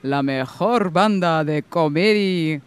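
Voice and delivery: slow, drawn-out announcer call; lucha libre announcer. Acted. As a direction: stretch out del mundo like a ring announcer. Mock Spanish announcer intro.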